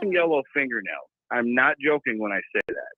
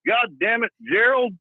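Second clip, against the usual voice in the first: higher, lightly voiced annoyed shop clerk; 8 semitones higher than usual. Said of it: "he goes".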